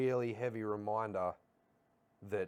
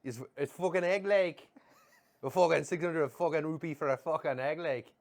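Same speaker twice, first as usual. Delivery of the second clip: high, loud Belfast outrage; Northern Irish accent; 7 semitones higher, 6 dB louder than usual. Retold or imitated. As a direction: sputter at the egg price in Belfast style. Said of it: "give me a Mickey and Tommy".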